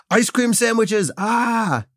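high, hyped-up boss impression; Michael Scott. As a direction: pitch it way up to drum up excitement. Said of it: "That's my Michael Scott impression".

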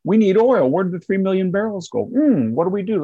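high-pitched, mocking fretting; mock dismay. Commentator voicing officials short of oil, mock dismay.

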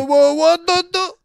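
sudden high, loud whoa; startled protest. Real, not acted. To his friend grabbing him, a startled high cry. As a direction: jump back in surprise, fending off.

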